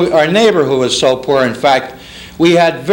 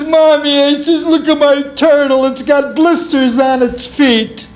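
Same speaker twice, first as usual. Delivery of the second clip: spoiled brat; 8 semitones higher than usual. Acted. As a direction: complain to mother like a spoiled child. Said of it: "I was a spoiled brat".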